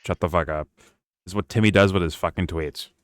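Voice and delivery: ordinary delivery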